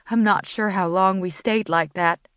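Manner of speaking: ordinary delivery, nothing marked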